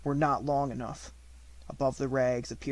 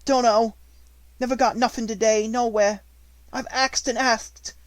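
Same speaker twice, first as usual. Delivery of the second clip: high, loud cockney urchin; a rough ragged beggar child; street urchin; 9 semitones higher, 8 dB louder than usual. A narrator's reading. Beggar child answers Sarah.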